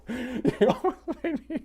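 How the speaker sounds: high, slow giggly protest; laughing disbelief. Real, not acted. Streamer protesting to chat through laughter.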